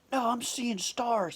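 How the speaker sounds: high, slow mock protest; exaggerated, imitated complaint; aggrieved victim. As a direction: play up the injury in a put-on voice. Man's complaint acted out.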